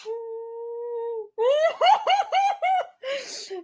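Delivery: long high-pitched laugh; helpless squealing laughter; real laughing fit. Real, not acted. Reader losing it over the fan fiction, laughing hard. Cracks up at the story.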